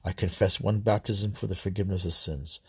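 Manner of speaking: even, subdued recital of a creed; prayer recitation. Real, not acted. Worshipper leading the Apostles' Creed, prayer recitation. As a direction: recite it steadily as a prayer, softly falling phrases.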